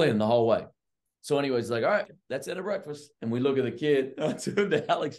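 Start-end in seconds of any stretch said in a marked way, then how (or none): 1.8-3.1 high-pitched imitation of the coach calling it off; mock head coach. Assistant imitating the coach ending breakfast, high mock voice. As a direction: mimic the coach shutting the meeting down.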